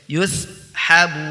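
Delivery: Yushabun cut short at the stop; short pausal reading. Shown as a demonstration. Teacher showing the pausal reading, short end. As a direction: end Yushabun without the long vowel. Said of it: "Kalau dibaca wakof baru dibaca panjang".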